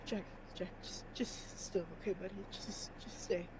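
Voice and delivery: soft, low, half-whispered plea; hushed soothing. Acted. Dirk kneels by fallen Jack, hushed and soothing. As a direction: settle him down very quietly.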